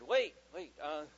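loud sudden waits, trailing fillers; imitating a kid playing for time; stalling child. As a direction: blurt it out, then fumble for words.

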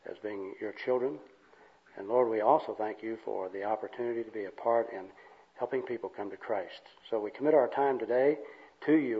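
soft, low public prayer. Real, not acted. Leads an opening prayer.

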